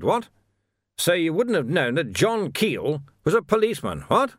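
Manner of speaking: high, swooping officious voice with tacked-on questioning "what?"; blustering captain. Acted. Captain triumphantly accusing a prisoner of robbery, blustering and high. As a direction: crow it as a pompous old-school officer.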